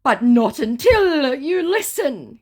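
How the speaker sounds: high, loud, slow put-on scammer voice; mock scammer voice. Scammer begging the narrator to listen, high slow mock voice. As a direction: plead slowly to be heard. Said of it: "he said".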